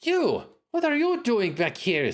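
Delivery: high, sharp woman's voice; woman cleric voice. Acted. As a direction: voice the cleric scolding him. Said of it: "says the cleric".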